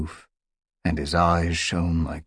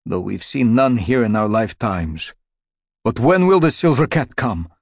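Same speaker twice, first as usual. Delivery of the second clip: higher, louder desperate plea; 5 semitones higher, 9 dB louder than usual. Acted. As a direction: beg for an answer, pitched up. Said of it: "he added desperately".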